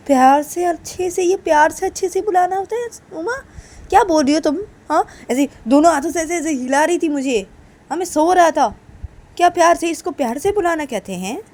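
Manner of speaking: high, loud incredulous questioning; acted quarrel, pitch pushed well up; indignant retort. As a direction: throw the words back in protest.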